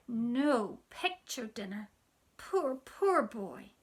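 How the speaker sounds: put-on slow, high, sorrowful little girl; child mourning the hungry boy in the picture; pitying child. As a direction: drag out the pity for the poor boy. Sister grieving for the boy.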